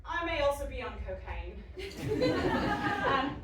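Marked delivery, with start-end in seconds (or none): 1.7-3.1 a short amused laugh. Acted. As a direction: laugh at the confession. Laughs at her own confession.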